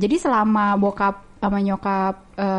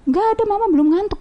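much higher mock mom voice; lightly voiced mother, pitched up high; imitating mom; 10 semitones higher than usual. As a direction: answer like a brushed-off mother. Mom answering child at night.

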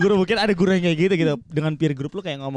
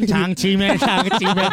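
mock street hawker; 3 semitones higher, much slower than usual. Mocking hawker at terminal.

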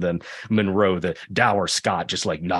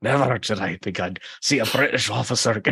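mock Scottish officer's accent; extremely Scottish accent; 2 semitones higher than usual. Host quotes Monroe calling Webb a coward, extremely Scottish accent. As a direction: put on a thick Scottish accent. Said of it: "with an extremely Scottish accent".

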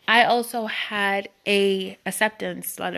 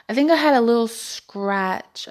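normal talking voice

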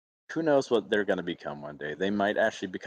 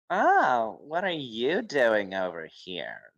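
imitated snooty sneer, drawn out; condescending, bitchy; 2 semitones higher, much slower than usual. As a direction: look down on him, stretching the words. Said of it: "this condescending, like, bitchy attitude".

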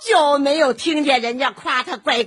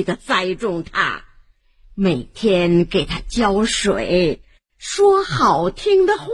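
put-on old woman asks the family for water; old granny; 2 semitones lower than usual. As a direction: speak like a gentle grandmother. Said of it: "是老婆婆".

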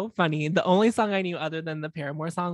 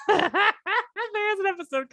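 high, drawn-out words through laughter; cracking up while talking; real helpless laughter into the words; laughing; 13 semitones higher, slower than usual. Real, not acted. Cracks up restarting the sentence.

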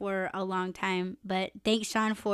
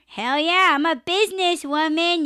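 high, loud boast of being a businesswoman; proud brag; 7 semitones higher, 6 dB louder than usual. Real, not acted. Bragging to listeners about her apps, loud and proud. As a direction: crow about it, pitched up.

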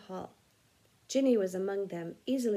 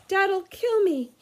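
panicked wail; 10 semitones higher, 9 dB louder, slower than usual. A narrator's reading. Ron panics over wrecked car.

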